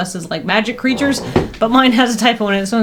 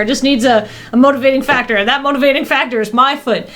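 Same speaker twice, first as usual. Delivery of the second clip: ad read voice; 4 semitones higher than usual. Acted. Reads sub commercial in character.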